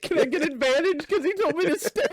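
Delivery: high-pitched, laughing request; laughing plea. Real, not acted. Player begging game master for advantage, laughing. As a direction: ask it while cracking up.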